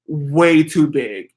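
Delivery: WAY TOO BIG drawn out slowly; emphatic stretch. Real, not acted. Telling viewers how huge the suitcase was, slow, drawn-out emphasis.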